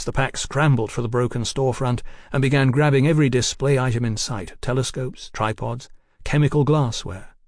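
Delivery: ordinary delivery, nothing marked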